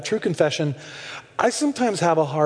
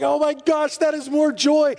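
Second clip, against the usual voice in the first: amazed; 8 semitones higher than usual. Retold or imitated. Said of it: "and I was like".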